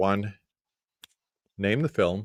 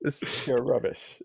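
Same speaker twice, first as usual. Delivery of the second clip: mock British accent; 5 semitones higher, 5 dB quieter than usual. Joins in the British accent banter.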